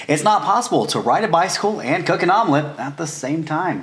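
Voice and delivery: measured newscaster read from a teleprompter; news anchor read. Acted. Presenter reading a teleprompter challenge as a news anchor. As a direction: deliver it like the evening news.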